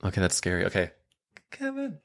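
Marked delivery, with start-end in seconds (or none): 1.5-2.0 very soft, high falsetto; tiny hushed high practice scream; marking it. Shown as a demonstration. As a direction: mark the scream quietly up high. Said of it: "I'm marking it".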